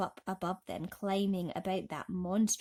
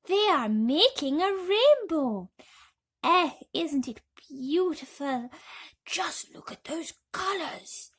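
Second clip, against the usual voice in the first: loud, higher, slow delight; delighted wonder; 8 semitones higher, 7 dB louder than usual. A narrator's reading. Travellers marvelling to each other at the rainbow. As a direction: marvel at the colours.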